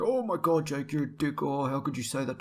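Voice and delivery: imitated offended gasp, starting high then easing; mock offended. Vlogger imitating people offended by his joke, mock outrage. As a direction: mimic someone overreacting to a joke. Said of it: "get way too offended and like".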